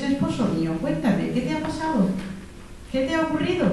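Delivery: gentle concern. Pretend. Wife gently asking her husband what happened. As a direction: coax him tenderly to talk.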